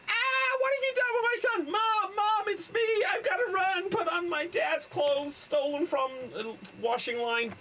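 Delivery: very high, frantic mock shriek; hammed-up panic, fast and squealing at first; panicked film characters. Mother confronts a stranger claiming to be her son, mock panic.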